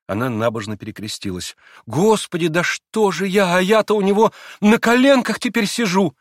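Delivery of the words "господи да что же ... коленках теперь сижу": higher, louder, alarmed; startled. A narrator's reading. Grushenka startled that she sits on his lap. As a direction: jump up in fright. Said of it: "как в испуге".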